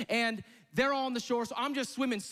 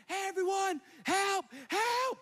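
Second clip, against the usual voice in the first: high desperate shout for help; yelling for help; 10 semitones higher, slower than usual. Retold or imitated. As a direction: call out to shore, panicking. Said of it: "I'm, like, screaming".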